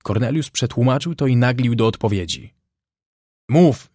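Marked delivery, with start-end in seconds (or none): 3.5-3.9 short, raised shout; shouted order. A narrator's reading. Brown orders the voice across the bay to speak, shouting. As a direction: shout it as a sharp command. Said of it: "krzyknął Brown".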